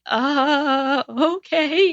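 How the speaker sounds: high, drawn-out mock okay; retold winded acceptance. Candidate retelling her reply to a rejection call, high winded okay. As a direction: act out taking bad news with the wind knocked out. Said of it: "the breath kinda goes out of you".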